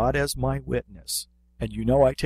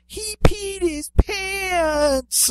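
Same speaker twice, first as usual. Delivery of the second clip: put-on a much higher, drawn-out child's shriek; kid screaming; much slower than usual. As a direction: shriek it like a tattling kid across the room. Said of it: "And he screams".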